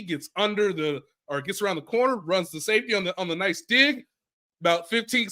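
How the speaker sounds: normal talking voice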